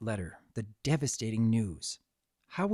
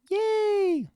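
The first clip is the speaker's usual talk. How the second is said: a much higher, gleeful sung yay; joyful cheer. Acted. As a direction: cheer like delighted foals. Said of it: "they both sang in unison".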